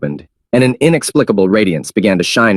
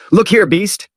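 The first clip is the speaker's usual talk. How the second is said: high angry call; 11 semitones higher, slower than usual. Acted. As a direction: snap it at the system. Angry at the system.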